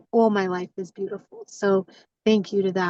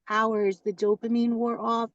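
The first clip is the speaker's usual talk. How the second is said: normal talking voice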